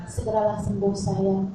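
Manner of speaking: low, loving husband voice; acted tenderness to a sick wife; tender. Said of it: "Orang kalau udah sayang gitu".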